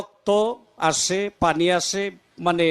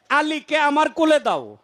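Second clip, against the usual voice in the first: much higher, emphatic retold call; proclaimed request; 8 semitones higher than usual. The Prophet asks his aunt for the newborn, proclaimed high. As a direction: proclaim it high, falling at the end. Quote repeated emphatically after rhetorical question.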